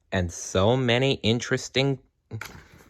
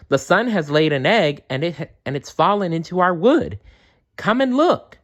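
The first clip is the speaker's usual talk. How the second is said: rising higher and higher with excitement; excited elf; 9 semitones higher than usual. Acted. Elf urges the frogs to come see the egg, more and more excited. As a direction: build up until the last words are very high. Elf announces big news.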